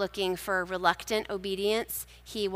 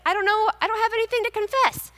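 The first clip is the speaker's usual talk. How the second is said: flustered protest; 13 semitones higher, 3 dB louder, faster than usual. Pretend. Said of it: "You may be like".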